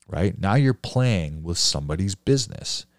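ordinary talk, nothing marked

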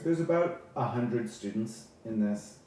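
normal talking voice

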